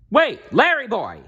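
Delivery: high, loud call to stop; shouting. A narrator's reading. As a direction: yell after him in alarm. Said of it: "shouted Alfred".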